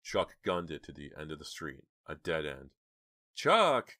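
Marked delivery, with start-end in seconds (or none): 3.3-4.0 high, loud cry of a name; high-pitched frightened call; alarmed cry. A narrator's reading. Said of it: "cried Jade".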